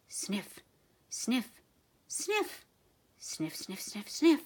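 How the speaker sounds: dog sniffing. A narrator's reading. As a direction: sniff the air like a hungry dog.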